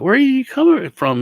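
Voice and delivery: put-on high, loud old-man question; a chirpy grandpa quizzing strangers; imitating grandpa asking. Grandpa asks visitors where they came from, high voice. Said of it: "he said".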